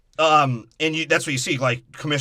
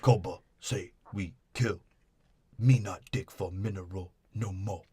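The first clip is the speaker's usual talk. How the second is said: lower, softer broken ape speech; mock ape Koba; 5 semitones lower, 7 dB quieter than usual. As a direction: growl it out like a grunting ape, softer. Koba voiced in broken English.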